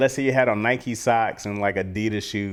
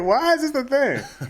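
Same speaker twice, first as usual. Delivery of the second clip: high, slow, laughing protest; mock bewilderment. Accused kid questioning the slang, high mock-exasperated whine. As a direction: play the baffled kid in a high stretched voice.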